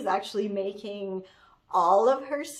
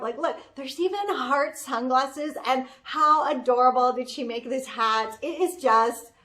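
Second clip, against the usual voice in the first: usual speaking voice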